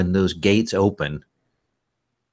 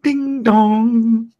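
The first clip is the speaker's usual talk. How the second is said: high, sustained sing-song chime; loud high "ding dong" chime; imitated doorbell; 5 dB louder than usual.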